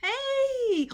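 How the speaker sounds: very high excited shout. Acted. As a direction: yell out with joy. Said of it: "he shouted".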